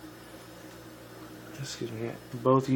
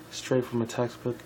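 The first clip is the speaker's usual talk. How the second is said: plain reading voice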